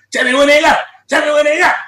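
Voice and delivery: high shouted chant; mock drunk shout. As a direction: yell it over and over like a drunk homie. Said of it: "He was like".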